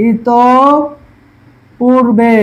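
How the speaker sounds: ইত পূর্বে drawn out, "itooo... puurbeee"; syllable-by-syllable pronunciation. Shown as a demonstration. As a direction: stretch each half of ইত পূর্বে, pause between. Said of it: "আমাদের দ্বিতীয় উচ্চারণ হবে".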